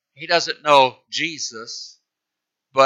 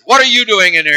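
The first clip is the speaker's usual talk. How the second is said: higher, louder, angry challenge; angry demand; 6 semitones higher, 7 dB louder, faster than usual. Acted. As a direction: catch them out and roar. Captain catches boys swapping jobs.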